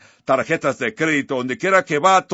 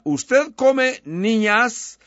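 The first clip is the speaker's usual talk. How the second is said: put-on much higher, slow and small, like a frightened girl; a small girl's voice, high and slow; timid girl; 8 semitones higher, much slower than usual. A narrator's reading. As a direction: ask it as a nervous little girl. Jill asks the lion timidly.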